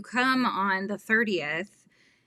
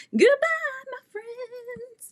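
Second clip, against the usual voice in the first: sing-song goodbye; slower than usual. Real, not acted. Signing off the livestream.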